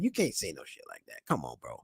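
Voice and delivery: low, soft disbelieving mutter; hushed come on bro; muttered aside. Real, not acted. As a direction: say it under your breath, head shaking.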